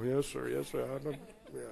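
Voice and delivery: put-on soft, hushed yes sir, a child caught out; meek child reply. A scolded boy quietly answers his stern father.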